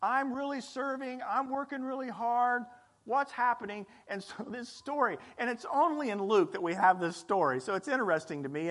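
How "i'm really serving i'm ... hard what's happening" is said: mock complaint. Sibling complains about doing all the work, mock whine. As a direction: whine that you're the only one working. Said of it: "there's always this".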